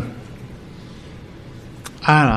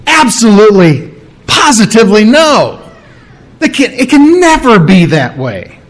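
emphatic no; 5 semitones higher than usual. Retold or imitated. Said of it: "Paul's going".

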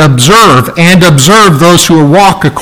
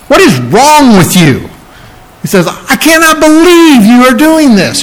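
incredulous scolding, pitch climbing high; exasperated rebuke; 9 semitones higher than usual. Retold or imitated. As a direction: play Paul scolding the Galatians in disbelief. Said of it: "so he's really upset".

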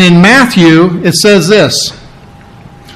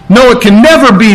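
higher, quick emphatic NO, it can never be; emphatic refusal; 4 semitones higher, 3 dB louder, faster than usual. Acted. Preacher translating the strongest Greek no, emphatic. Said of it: "it's the most emphatic".

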